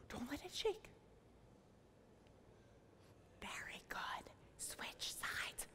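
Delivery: whispered, barely there; hushed. Pretend. Yoga teacher to children with a butterfly on their trees, hushed whisper. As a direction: whisper so as not to disturb it.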